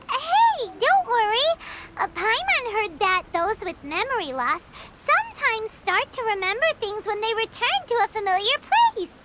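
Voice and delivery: ordinary talk, nothing marked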